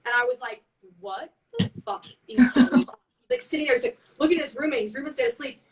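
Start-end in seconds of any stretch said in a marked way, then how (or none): 1.0-3.0 shocked disbelief. Retold or imitated. Retold shocked reaction to shaking bed.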